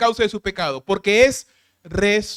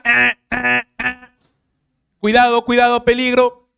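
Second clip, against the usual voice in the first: alarm voice calling cuidado, louder; mock alarm; 5 dB louder than usual. Pastor voicing a red light warning people, mock alarm. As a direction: sound like a warning signal.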